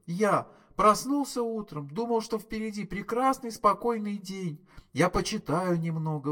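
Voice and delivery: high, aggrieved wail of a ruined day; wailing complaint. A narrator's reading. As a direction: whine it like the worst day of your life. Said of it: "взвыл Артур".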